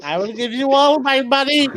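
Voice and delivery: high pleading villain voice; evil scientist. Acted. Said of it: "evil scientist voice".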